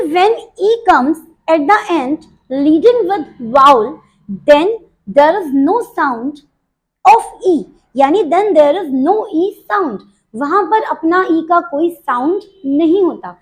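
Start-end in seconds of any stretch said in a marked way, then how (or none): 0.1-10.0 slow rule dictation. Shown as a demonstration. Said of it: "एक rule होता है ये".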